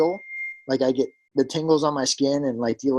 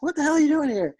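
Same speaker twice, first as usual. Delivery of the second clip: surprised; 12 semitones higher, faster than usual. Retold or imitated. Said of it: "I'm like".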